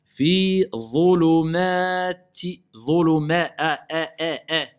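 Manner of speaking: dhulumati chanted with a thin "mè" vowel; drawn-out dhulumati, thin open vowel; slow recitation. Shown as a demonstration. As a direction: hold dhuluma, then repeat a as a light "è". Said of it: "C'est pas a".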